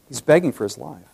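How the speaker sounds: normal talking voice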